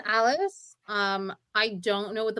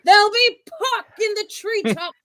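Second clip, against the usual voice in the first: slow, raised dramatic delivery; theatrical Eleanor; 9 semitones higher, 8 dB louder, slower than usual. Pretend. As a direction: declaim it slowly like a stage queen. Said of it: "it's Eleanor talking to Henry".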